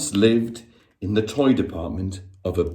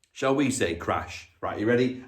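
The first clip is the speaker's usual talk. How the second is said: plain reading voice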